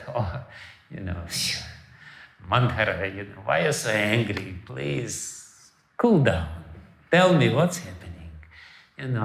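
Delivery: low, soft, soothing; calm her down gently, unbothered; calm queen. Retold or imitated. Queen soothing her upset maid, low and soft.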